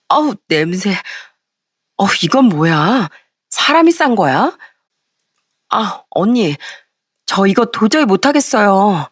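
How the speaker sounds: disgusted whining with slow, drawn-out words; whiny complaint. A narrator's reading. Sister-in-law told to clean up dog mess, whining. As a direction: whine like a put-upon sister-in-law. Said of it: "어찌나 찡찡대던지".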